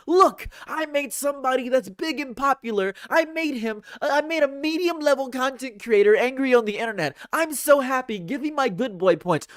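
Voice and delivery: high mock-proud brat voice bragging about a win; mocking troll voice. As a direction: taunt like a smug kid bragging to grandma. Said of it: "go to their grandparents and say".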